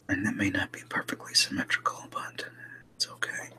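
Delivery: soft whispering to self; leaning in to whisper. Real, not acted. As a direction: whisper it as if talking to yourself. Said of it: "I'm just gonna go to a whisper voice now".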